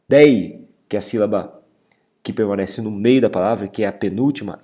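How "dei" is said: dei said alone, loud and punched; strongest syllable. Shown as a demonstration. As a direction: hit dei hard as the stressed syllable. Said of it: "é a mais forte".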